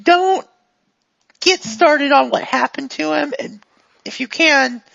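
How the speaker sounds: lightly voiced handler giving a heads-up; Sigrid. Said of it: "the voice of Sigrid".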